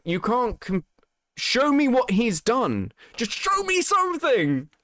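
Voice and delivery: rising, pleading-annoyed outburst; exasperated demand. Real, not acted. Streamer demanding proof from an absent creator, exasperated.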